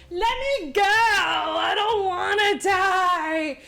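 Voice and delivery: high, dragged-out fake sob; mock pleading. A movie unicorn begs for its life in a mock sob. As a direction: whimper it like a doomed unicorn.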